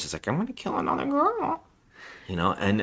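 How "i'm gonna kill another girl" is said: mock threat in high duck quacks. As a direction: quack it like a menacing duck caller. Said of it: "somebody who talked like a duck".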